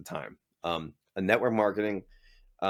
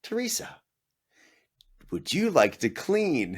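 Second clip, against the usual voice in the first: manager offer; 8 semitones higher than usual. Retold or imitated. Manager voiced offering job.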